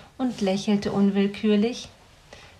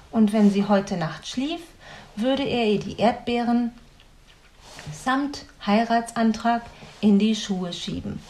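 plain reading voice

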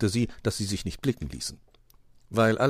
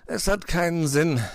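higher-pitched, resigned complaint; 10 semitones higher, 4 dB louder than usual. A narrator's reading. Fox reports failure to friends, resigned. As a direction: give up with a touch of defeat. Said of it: "sagte Herr Fuchs resigniert".

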